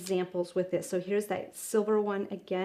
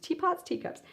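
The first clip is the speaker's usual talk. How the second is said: playful self-correction; 10 semitones higher than usual. Real, not acted. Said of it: "I always get those confused".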